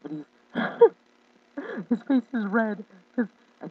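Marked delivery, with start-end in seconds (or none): 1.9-3.3 high, loud, breaking into laughs; talking through laughter. Real, not acted. Describing a friend laughing on the floor, cracking up. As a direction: squeeze the words out while laughing.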